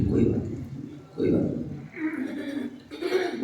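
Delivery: very soft, almost whispered brush-off; hushed reassurance. Retold or imitated. Ram brushes off Sita's worried question, hushed reassurance. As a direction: let it fade to nearly nothing.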